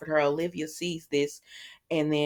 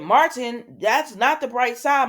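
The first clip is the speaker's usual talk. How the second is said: raised voice; 6 semitones higher, 6 dB louder than usual. Retold or imitated. Upset wife confronts Martin.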